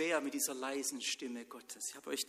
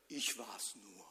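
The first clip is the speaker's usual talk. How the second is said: low, soft, weary eye-roll; 5 semitones lower, 9 dB quieter, slower than usual. Acted. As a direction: brush the boy off with a sigh. Eli hätte die Augen verdreht. (Eli verdreht die Augen, tief und leise)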